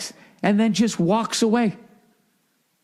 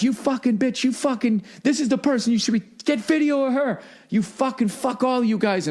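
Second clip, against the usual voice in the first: higher-pitched imitated yelling; mock furious rant; 6 semitones higher than usual. Driver ranting at onlookers with phones, mock angry. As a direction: spit it at the crowd filming. Driver yells at people filming.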